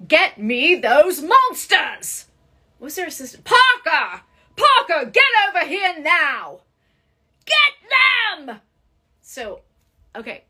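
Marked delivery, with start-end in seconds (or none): 0.1-2.3 loud, high, drawn-out Australian-style cartoon command; Doctor Ellen. Shown as a demonstration. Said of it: "Can you do Doctor Ellen from Teenage Mutant Ninja Turtles". 3.4-8.6 high, shouted cartoon orders in an Australian-style accent; Doctor Ellen. Shown as a demonstration. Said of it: "Can you do Doctor Ellen from Teenage Mutant Ninja Turtles".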